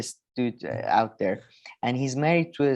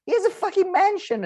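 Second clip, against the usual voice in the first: much higher, sudden exasperated burst; exasperated outburst; 3 dB louder than usual. Real, not acted. Ranting to his co-host about Bezos, high exasperated burst. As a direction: spit it out in disbelief. Ranting at naive oligarch talk.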